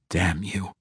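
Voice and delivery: quiet, strained curse; pained curse. A narrator's reading. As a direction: curse under your breath through pain. Tormented captive curses his captor.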